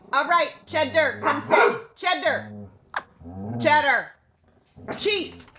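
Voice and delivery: high firm call to a dog. Real, not acted. Calling dog to come.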